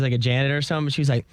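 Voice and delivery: ordinary talk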